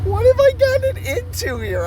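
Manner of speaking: very high, thin mock-panicked squeak; comic falsetto of dismay; squeaky disbelief. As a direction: squeak it out like you can't believe this. His own alarmed reaction, squeaked.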